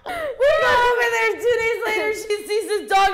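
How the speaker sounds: high, loud, laughing so hard it sounds like crying. Real, not acted. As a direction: gasp it out through tears of laughter.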